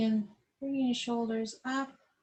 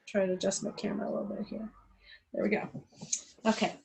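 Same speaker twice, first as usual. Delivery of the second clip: ordinary delivery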